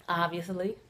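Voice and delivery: ordinary talk, nothing marked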